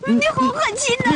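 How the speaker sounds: high, crying accusation; wounded cry that he is heartless; tearful reproach. Acted. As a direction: sob it at him. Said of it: "别哭了".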